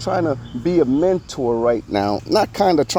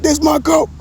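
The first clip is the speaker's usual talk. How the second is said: mocking imitation, pitched up high and gushing; hyped fanboy; 11 semitones higher than usual. Host mocking Academics' goat talk, hyped-up imitation. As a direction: ape the hype like an overexcited fan. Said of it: "Academics just last night was talking about".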